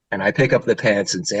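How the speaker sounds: normal talking voice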